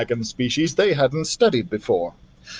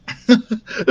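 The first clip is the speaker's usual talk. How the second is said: a light laugh; 8 semitones higher than usual. Acted. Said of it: "said Billy with a laugh".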